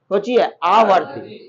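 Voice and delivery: आवर्ती drawn out slowly and deliberately; slow emphasis. Shown as a demonstration. Teacher telling class to think, slowly stressing आवर्ती. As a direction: spell out आवर्ती slowly so it sticks. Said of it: "teacher stresses key term".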